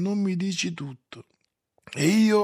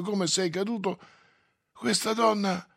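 plain reading voice

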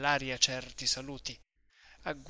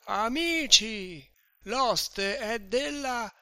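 put-on much higher, louder, slow drunken shout; yelling; 9 semitones higher, 4 dB louder, much slower than usual. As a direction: call out to the friends downstairs. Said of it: "cominciava a urlare più forte ancora". (urlo ubriaco verso la scala, lento e più forte)

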